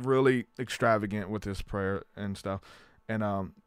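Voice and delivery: usual speaking voice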